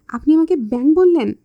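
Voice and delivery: offended outburst. Acted. Mehbuba confronts Labib for calling her a frog. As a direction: take offence at the word frog.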